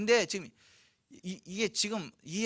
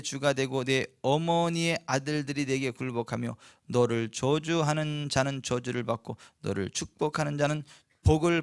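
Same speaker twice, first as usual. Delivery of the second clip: scripture reading; 4 semitones lower than usual. Acted. Pastor reading Genesis to the congregation, solemn. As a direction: read it out like holy writ. Preacher reads scripture aloud.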